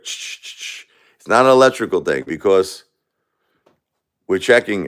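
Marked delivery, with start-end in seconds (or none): 0.0-0.8 quick, soft hissed shushes; imitated flickering lights. Widower showing how the lights flicker, soft imitated hissing. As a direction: make short whispery bursts for flickering lights. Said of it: "And the lights will flicker".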